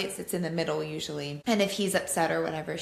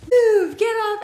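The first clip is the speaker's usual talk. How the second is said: higher, much louder mock shout; playful yell; 12 dB louder, slower than usual. Mom imitating her daughter yelling at her brother in a game. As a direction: shout it at the giggling little brother. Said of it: "she's like".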